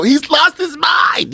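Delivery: high, drawn-out mock wail; mock outcry. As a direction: cry it out like a theatrical accuser. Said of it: "turn around and go".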